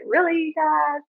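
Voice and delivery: high-pitched, drawn-out question to Dad; retold plea in a high voice; incredulous appeal skyward. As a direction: call it up to the heavens, high. Calls up to dead father.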